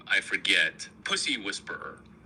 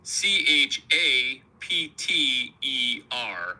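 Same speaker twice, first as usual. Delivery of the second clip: slow letter-by-letter spelling; deliberate spelling out; spelled out slowly; 4 dB louder, slower than usual. Shown as a demonstration. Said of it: "That spells chapter".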